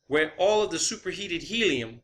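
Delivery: normal talking voice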